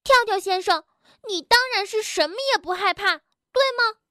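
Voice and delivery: high, cross challenge; angry. Acted. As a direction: challenge indignantly, pitched high. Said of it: "蹦蹦生气地说". (生气地高声质问)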